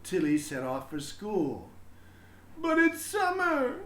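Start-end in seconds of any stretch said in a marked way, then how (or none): 2.6-3.8 put-on high, loud child's voice calling; a kid brother protesting from the doorway; little brother calling out. A narrator's reading. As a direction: call out like an upset little boy. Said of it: "her brother called".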